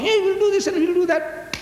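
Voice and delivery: high-pitched mocking chatter; mock boasting.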